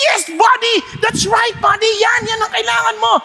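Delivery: root for your buddy to run; cheering pig. Acted. Pig cheering the recovered horse, high excited shouts.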